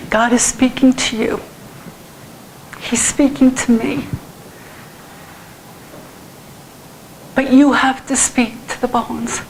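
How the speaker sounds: slow, halting plea through tears; real tearful. Real, not acted. Preacher urging congregation to speak to the bones, tearful. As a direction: choke out each phrase with long pauses. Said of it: "I don't know why I'm crying".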